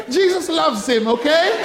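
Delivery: high-pitched, laughing through the words. Real, not acted. Preacher reassuring congregation about his brother, laughing. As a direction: squeeze the words out between laughs.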